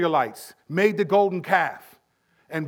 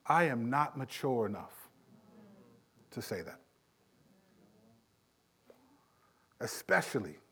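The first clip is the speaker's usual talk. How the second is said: quiet confession; 8 semitones lower, 12 dB quieter than usual. Real, not acted. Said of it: "confessional aside".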